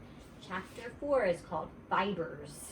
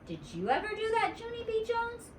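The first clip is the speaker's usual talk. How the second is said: high, quick prying question; suspicious little girl. A narrator's reading. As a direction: probe like a nosy detective. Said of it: "very suspicious".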